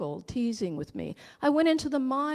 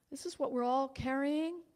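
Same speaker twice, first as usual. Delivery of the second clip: ordinary talk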